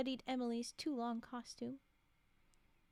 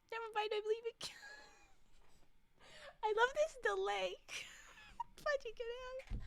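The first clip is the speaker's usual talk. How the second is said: laughing; 11 semitones higher than usual. Real, not acted. Laughing reading chat teasing.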